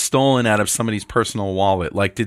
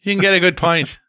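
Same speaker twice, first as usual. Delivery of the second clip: higher-pitched, hammed-up Irish; mock Irish accent; 7 semitones higher, 3 dB louder than usual. Repeating the Dublin pub joke, higher mock Irish accent. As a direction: play at an Irish brogue. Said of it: "What is that accent?".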